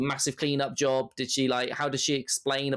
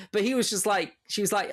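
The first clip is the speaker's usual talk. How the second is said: ordinary talk, nothing marked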